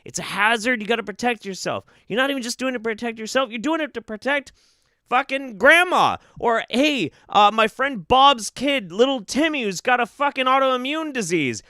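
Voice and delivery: ordinary talk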